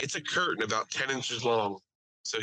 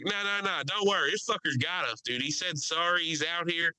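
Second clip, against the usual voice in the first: higher-pitched loudmouth passenger vouching; imitated pilot defender; 5 semitones higher than usual. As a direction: reassure the cabin as the rowdy passenger. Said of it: "Now he's on the pilot's side".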